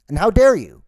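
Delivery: loud and high, hitting dare hard; outraged shout. Acted. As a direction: blow up on the repeat, punching dare. Repeats line, second take shouted.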